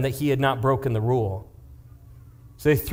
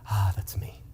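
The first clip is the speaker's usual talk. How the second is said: whispered realization; 6 semitones lower, 6 dB quieter than usual. Acted. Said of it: "he goes".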